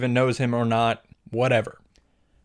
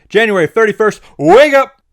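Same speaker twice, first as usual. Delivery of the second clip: sudden high, loud shout; shouted wake-up; 8 semitones higher, 10 dB louder than usual. Acted. Snapping out of the flashback on January thirty-first, shouted wake-up. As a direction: jolt a sleeper awake.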